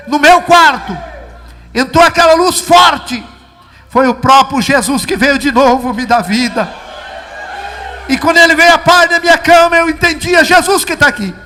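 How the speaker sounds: fervent preaching. Real, not acted. Man telling the church Jesus entered his room, fervent preaching. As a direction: proclaim the vision to the congregation.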